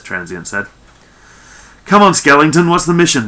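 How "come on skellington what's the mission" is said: loud, higher-pitched impatient demand. A narrator's reading. Norell pushing the captain for the mission, loudly demanding. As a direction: press hard for an answer, voice raised. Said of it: "Norell demanded".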